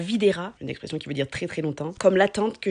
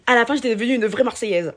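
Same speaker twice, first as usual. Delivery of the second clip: usual speaking voice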